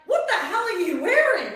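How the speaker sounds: a dad shocked at his daughter's outfit, loud and laughing; dad yelling at me. Retold or imitated. Imitating dad shocked by daughter's outfit, laughing mock yell. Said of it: "And my dad's like".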